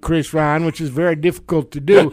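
loud, mumbling, mouth full of marbles; Mitch McConnell, marble-mouthed. Acted. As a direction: talk like your cheeks are stuffed with marbles. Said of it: "Was that English?".

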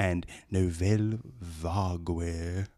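stuffy British guy. Acted. Reader finishing the movie blurb, stuffy British voice. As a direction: draw out the French in stuffy British. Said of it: "Stuffy British guy?".

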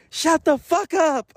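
high, drawn-out mock yell; imitated yell. Teacher recalling her student's order to classmates, mock high yell. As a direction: imitate a kid yelling, high and slow.

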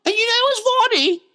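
very high-pitched English impression; Russell Brand. Shown as a demonstration. Mocking him making a point, high as Russell Brand. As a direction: pitch it right up, English-accented. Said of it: "He's a little high up here".

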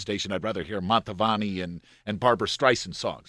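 loud, fast mock scolding of rock music; a huffy square griping about rock and roll; disapproving old-timer. Radio man mocks old guard complaining about rock, loud huffy mock. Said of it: "that kickback about".

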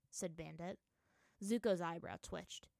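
ordinary delivery, nothing marked

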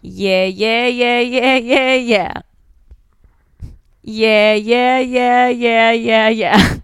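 evenly spoken string of yeahs on one held note; interpretive lyric read. Shown as a demonstration. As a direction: chant the yeahs steadily without singing. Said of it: "it's an interpretive read".

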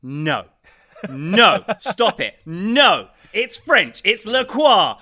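loud, exasperated no's; retold exasperated shout. Telling critics off about La Croix, exasperated shout. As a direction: shout them down, fed up. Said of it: "And I'm just like".

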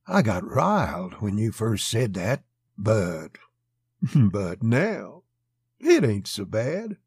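slow, lightly voiced menacing man; gently menacing murmur. A narrator's reading. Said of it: "he whispered gently".

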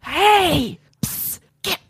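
whisper yelling. Retold or imitated. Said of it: "whisper yelling at this thing".

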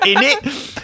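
high British tag question through laughter; giggly, squeaky British accent. Acted. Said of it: "gotta do my British accent".

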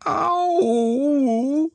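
very high, drawn-out wail; high cried ow. A narrator's reading. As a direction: wail ow like a tiny creature. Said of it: "cried Piglet".

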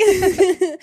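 high forced giggle; awkward fake laugh. Shown as a demonstration. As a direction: fake a laugh to ease tension. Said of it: "but it wouldn't be a real laugh".

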